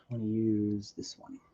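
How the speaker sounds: whispered to himself. Real, not acted. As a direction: whisper it under the breath. Muttering to self while choosing brush.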